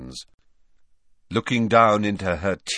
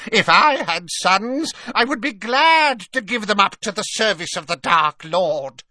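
ruthless Bellatrix; 12 semitones higher, 5 dB louder than usual. A narrator's reading. Bellatrix mocking her sister's grief, ruthless. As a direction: sneer it coldly. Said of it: "said Bellatrix ruthlessly".

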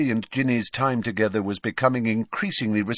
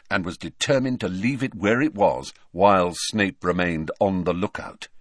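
ordinary delivery, nothing marked

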